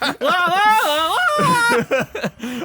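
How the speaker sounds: very high wailing "la la"; mock screeching. Friend mimicking player screeching at the fish, mock screech. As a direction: imitate a constant high screech. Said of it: "I'm just screech-, screeching at the fish".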